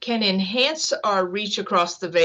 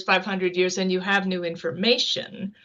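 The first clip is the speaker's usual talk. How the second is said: ordinary delivery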